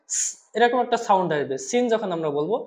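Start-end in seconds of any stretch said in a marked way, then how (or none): 0.1-0.3 স as a strong held hiss; firm sibilant. Shown as a demonstration. Teacher shows the Sin sound, firmly hissed. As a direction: press out a sharp sustained স. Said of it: "সিনটা শক্ত করে".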